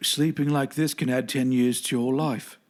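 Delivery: put-on lightly done British roadie accent, a bit off; a quick attempt at an English roadie voice, not quite landing; Del Preston accent. Friends quoting a movie, doing a so-so British accent. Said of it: "I can't do the accent very well".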